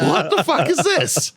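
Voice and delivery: very high, slow, drawn-out incredulity; hammed-up shock, squeaking way up high; comic outraged disbelief. Man retelling his reaction to warrant news, comically aghast.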